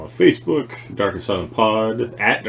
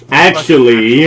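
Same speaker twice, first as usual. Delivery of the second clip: nerdy geek voice; 5 semitones higher, 11 dB louder, slower than usual. Pretend. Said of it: "the nerdy acne comic book geek".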